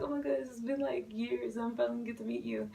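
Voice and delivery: high, soft, hurried gushing; excited fangirl. Retold or imitated. Fan retelling finally meeting Jonah, soft high excited rush. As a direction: replay starstruck gushing in a small rushed voice. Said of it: "I'm so excited".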